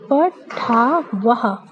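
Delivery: पठावह said very slowly, ending in a clear "ha"; पठावह, slow and clear with the visarga sounded as "ha". Shown as a demonstration. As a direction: drag out पठावह syllable by syllable, voicing the final "ha". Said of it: "विसर्ग... ह करके उच्चारित करते हैं".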